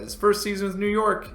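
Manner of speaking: checking a stat you can't believe; surprised question. Real, not acted. Streamer double-checks a player's stats, surprised and rising.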